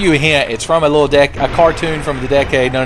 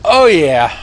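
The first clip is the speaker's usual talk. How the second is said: boastful intro; 8 semitones higher, 5 dB louder, slower than usual. Pretend. Self-hype announcement while playing.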